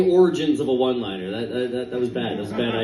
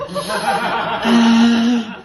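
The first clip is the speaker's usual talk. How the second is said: dad's mock groan; 5 semitones higher, 5 dB louder than usual. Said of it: "he was like".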